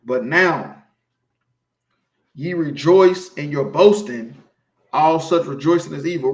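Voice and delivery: scripture reading. Acted. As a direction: read the warning like holy writ. Reads scripture aloud.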